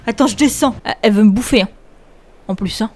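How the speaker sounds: ordinary delivery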